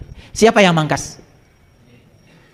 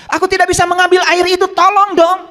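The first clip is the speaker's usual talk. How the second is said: very high pleading cry; high-pitched, desperate call for help; 13 semitones higher than usual. Acted. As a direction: plead high as the helpless tree. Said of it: "Itu pohon sedang teriak".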